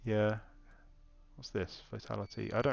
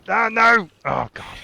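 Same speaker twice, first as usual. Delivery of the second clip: high, loud real cry of dismay; sudden loud groan of disappointment; 12 semitones higher, 13 dB louder, slower than usual. Real, not acted. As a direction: blurt it out as the chance slips away. Loot fails, enemy escapes.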